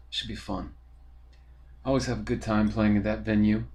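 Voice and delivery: ordinary talk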